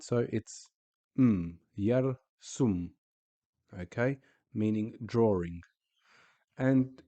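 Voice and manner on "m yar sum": "m, yar, sum" broken into pieces; split syllables. Shown as a demonstration. Teacher splits the word for students, slow. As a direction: break the word into its syllables, slowly. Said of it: "no more letters or vowels beyond this point".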